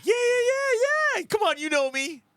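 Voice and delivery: very high, loud mock shout; hammed-up squeal brushing off the doubt; cocky insistence. Customer assuring restaurant staff he can take it, cocky insistence.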